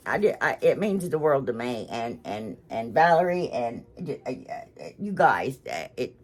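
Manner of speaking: halting, choked-up stammer; stuttering. Real, not acted. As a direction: trip over the words, moved. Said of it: "I'm gonna start stuttering".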